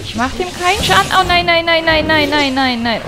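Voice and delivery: high, loud panicked string of nein; squealing in real panic as the boss hits; panicked wail. Real, not acted. Player losing a boss fight, high panicked wailing.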